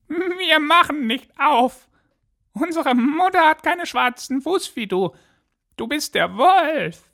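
very high, put-on kid voice; squeaky-high little goats, accusing; defiant goat kids. A narrator's reading. Goat kids tell the wolf he is found out, defiant squeaky voice. Kids refuse after seeing paw.

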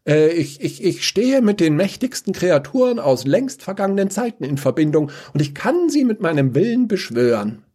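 high, stuttering proud claim; stammering boast. Acted. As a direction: stumble into a grand boast. Dinomant stammers his boast.

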